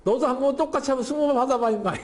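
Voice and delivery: high, playful get-even taunt; comic taunt. Acted. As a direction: play it as comic payback, breaking into laughter. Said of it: "거의 코미디 같은 장면인데".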